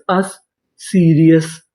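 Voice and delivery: Serious said slowly after the sound अस; slow blending. Shown as a demonstration. Teacher blending sounds into serious for students, slowly. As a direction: stretch out अस and serious. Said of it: "तो meaning क्या बनी?".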